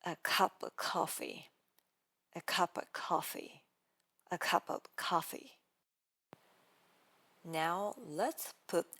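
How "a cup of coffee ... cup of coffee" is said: a cup of coffee run together as one word, cup's p launching the vowel; blended demo. Shown as a demonstration. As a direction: roll a cup of coffee smoothly as one long word, of reduced to a schwa. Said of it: "let's practice".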